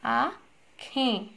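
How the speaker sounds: आँखें drawn out very slowly, syllable by syllable; slow careful model. Shown as a demonstration. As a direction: stretch आँखें out slowly so the class catches the ending. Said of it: "आँखें लिखोगे तो शब्द गलत हो जाएगा".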